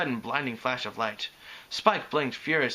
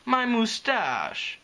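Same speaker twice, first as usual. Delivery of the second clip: high, drawn-out whine; lamenting; 9 semitones higher, much slower than usual. A narrator's reading. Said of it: "he lamented".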